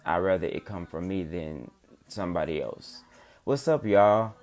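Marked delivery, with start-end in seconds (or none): 3.4-4.3 excited greeting. Real, not acted. Greeting new live viewers.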